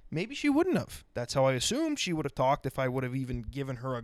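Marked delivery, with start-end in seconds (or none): 0.1-1.0 high, prissy mimicking voice; mock her in a high put-on voice; mock woman's voice. Host mocking how a woman would have talked, high and prissy. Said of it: "talked like this".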